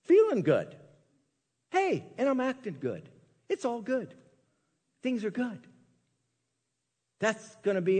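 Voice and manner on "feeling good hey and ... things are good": lightly voiced preening, a little higher; gently played pleased-with-myself voice; mock self-admiring. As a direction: chirp compliments to yourself in the mirror, lightly. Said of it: "look in there and go".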